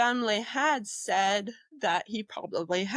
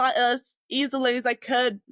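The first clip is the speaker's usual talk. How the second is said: ordinary talk, nothing marked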